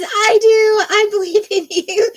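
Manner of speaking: high, loud, bubbly cheerleader read of "I do. I believe in you."; excited cheer. Retold or imitated. Streamer reads a viewer's cheer aloud, high and excited. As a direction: squeal it like an overjoyed cheerleader. Reading Pinky's cheering chat message.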